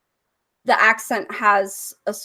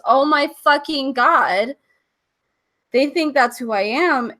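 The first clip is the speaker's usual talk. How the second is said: slow, louder, higher dawning shock; shocked realization; 4 semitones higher, 4 dB louder than usual. Retold or imitated. Realizing fans think the character is her, slow shocked. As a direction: let the penny drop slowly.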